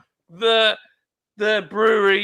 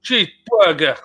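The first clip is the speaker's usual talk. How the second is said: Boston cheeseburger. Retold or imitated. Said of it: "say cheeseburger".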